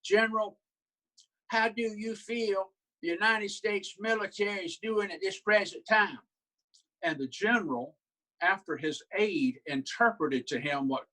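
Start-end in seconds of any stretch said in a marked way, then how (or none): 0.0-6.2 higher, old Southern senator impression; Strom Thurmond voice. Senator speaking on the Senate floor, higher old Southern senator voice. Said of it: "He was saying to him something like".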